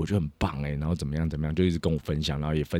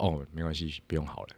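softly voiced polite refusal, much softer; shy decline; 11 dB quieter than usual. Girlfriend awkwardly declining a photo with the star, quiet. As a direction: mimic her shy little no-thanks.

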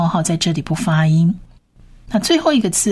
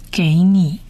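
ordinary talk

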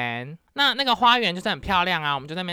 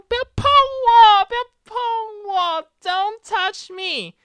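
much higher, then a loud, slow shriek; freaked out; 7 dB louder than usual. Retold or imitated. Vlogger recalls pulling her hand away in disgust, shrieking. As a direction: recoil and shriek at his touch. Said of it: "我吓疯".

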